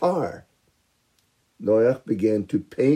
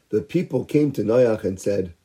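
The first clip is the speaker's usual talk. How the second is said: plain reading voice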